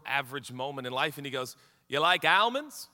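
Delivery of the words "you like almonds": put-on accented almonds with a flat first A; commercial character's accent. Driver casually asking his friend, in the ad's accent. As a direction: mimic the ad driver's accent, questioning lift. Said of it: "I hope that this accent".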